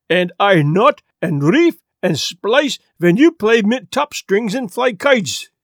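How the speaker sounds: put-on heavy Swedish sailor's accent, lecturing; old Swedish seaman scolding a boy, thick Swedish accent; gruff Swedish accent, preaching seniority. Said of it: "the Swedish sailor exploded".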